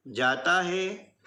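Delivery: जाता है slowly, the आ drawn out; slow aa demo. Shown as a demonstration. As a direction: hold the आ in जाता. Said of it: "आ और ई की मात्राओं के उच्चारण अलग-अलग". (जाता धीरे, आ लंबा खींचें)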